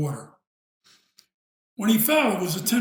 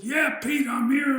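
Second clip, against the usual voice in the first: higher, raised mock shout; retold shout, pitched well up; imitated calling back; 8 semitones higher, 4 dB louder than usual. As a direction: answer a friend's call from below. Retold answer called up from water.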